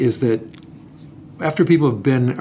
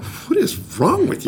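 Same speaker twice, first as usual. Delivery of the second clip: mock disbelief; 11 semitones higher than usual. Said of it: "I'd be like".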